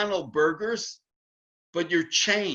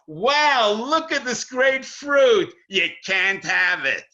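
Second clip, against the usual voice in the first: mock taunt; 4 semitones higher, 5 dB louder, slower than usual. Teacher voices taunting showing of fruit.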